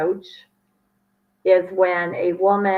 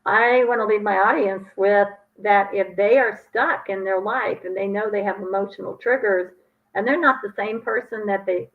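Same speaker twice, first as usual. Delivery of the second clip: usual speaking voice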